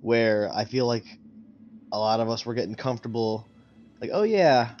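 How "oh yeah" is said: ordinary talk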